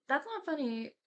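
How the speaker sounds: ordinary talk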